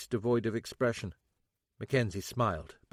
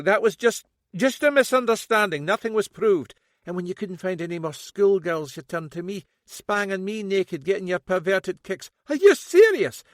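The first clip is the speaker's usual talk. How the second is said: loud high bitter accusation; bitter daughter; 8 semitones higher, 7 dB louder than usual. A narrator's reading. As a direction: spit the accusations at him.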